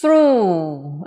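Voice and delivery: "Through" loud, held on a long oo; ends on u. Shown as a demonstration. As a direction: draw out "Through" with no consonant at the end. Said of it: "Chỉ phát âm là u là hết".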